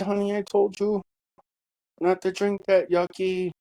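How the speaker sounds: gentle scolding. Retold or imitated. Father gently scolds dying daughter.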